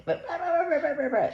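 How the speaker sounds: high mocking blah blah; a high singsong blah imitating the dogs; mock barking blah. Mocking the dogs barking at the neighbor, high singsong. Mimicking dogs' barking noise.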